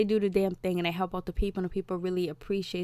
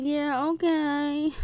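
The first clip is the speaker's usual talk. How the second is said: higher, slow, dismissive yeah okay; higher, slowly drawn-out, teenage indifference; unimpressed teen; 7 semitones higher, much slower than usual. Retold or imitated. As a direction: brush him off like a bored teen. Voicing unimpressed teen Riley.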